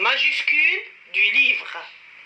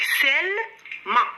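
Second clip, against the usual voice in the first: "سل" held and rising, then a separate short "ما"; syllable by syllable; 4 semitones higher, slower than usual. Shown as a demonstration. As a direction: pause between "سل" and "ما". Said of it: "الصوت الأول هو سل".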